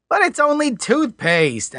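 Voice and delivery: put-on high, slow whiny protest from young Alan. A narrator's reading. Alan protests to the customs official, high and whiny. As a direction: plead it like a put-out young man. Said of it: "Alan protested".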